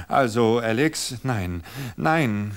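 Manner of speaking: loud, high panicked protest; frightened cry. Acted. Wife pushed against the wall, loud frightened cry. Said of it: "schrie sie".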